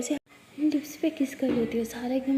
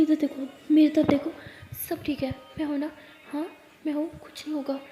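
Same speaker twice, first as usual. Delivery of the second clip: soothing; 3 semitones higher than usual. Acted. Sara holds Jess's face and calms her, soothing. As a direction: gently talk her down from panic.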